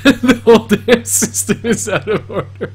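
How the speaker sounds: shouted through laughter; laughing, loud and high. Real, not acted. Quoting while laughing.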